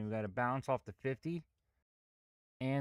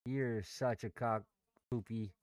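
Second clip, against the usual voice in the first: ordinary delivery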